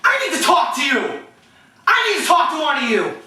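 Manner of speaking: high, insistent shouted demand; irate coach. Retold or imitated. Coach demanding a word with the refs after a flag, irate. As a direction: imitate a coach hollering for a referee. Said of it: "Here's my coach impressions".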